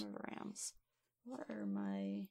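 whispered under breath; whispered note. Real, not acted. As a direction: mutter it to yourself writing. GM whispers it while noting.

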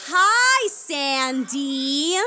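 put-on high, loud kids' party host; singsong puppet show host. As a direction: pump up the kids like a children's entertainer. Clown hosting kids' party.

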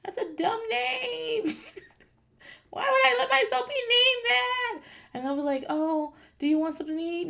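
put-on high, quavering old lady complaint; a bitter old granny still griping; shrill sobbing outrage. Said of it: "I'm like".

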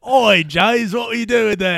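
loud, high, hammed-up Cockney; bad Cockney accent. Gadget man hailing James, bad Cockney accent. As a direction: holler it in terrible mock Cockney. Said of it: "a really bad Cockney accent".

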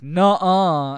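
loud mock kid's nuh-uh; hammed-up schoolyard comeback; childish retort. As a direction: bark it like a bickering child. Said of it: "they literally say".

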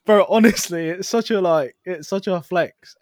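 high, loud laughing. Real, not acted. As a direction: crack up mid-sentence. Laughing about Karen.

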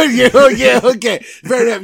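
high, loud laughing yeah; laughing surprise. Real, not acted. Host laughing at his co-host's one-word rating.

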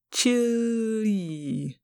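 CHILI stretched very long and soft; drawn-out word. Acted. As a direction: draw out the vowels of "chili", gliding slowly down. Alien savours the word aloud.

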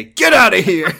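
high, loud hammed-up yell, laughing; mock yell. Guards throwing Kiryu out of the funeral, laughing yell. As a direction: chase him off with a laughing shout. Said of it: "And then they say".

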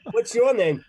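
high, loud Boston question; Boston accent. Acted. Oz character asks a newcomer's name, loud Boston accent. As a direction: ask it loudly in broad Boston. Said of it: "Bostonian accent".

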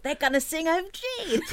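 high, drawn-out thrilled cry; excited thought. Retold or imitated. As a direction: relive the giddy anticipation, laughing. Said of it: "but I thought".

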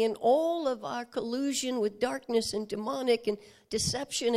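loud, driving preacher's build; rising preaching. Acted. Preacher building before the congregation, loud and driving. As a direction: pile the sins on, full force.

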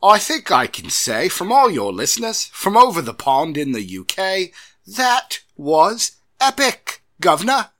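loud, hammed-up English accent; Michael Caine. Host reading listener's praise, exaggerated English accent. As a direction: stretch it out high in stagey Cockney English. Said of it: "English accent there".

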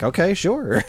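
bright high "okay, sure"; high-pitched okay. Retold or imitated. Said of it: "I was like".

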